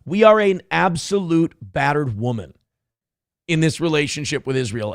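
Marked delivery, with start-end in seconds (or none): none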